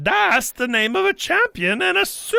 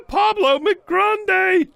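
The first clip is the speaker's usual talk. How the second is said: laughing high and slow; slow laughing praise; 8 semitones higher, slower than usual. Acted. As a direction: laugh out the name in delight.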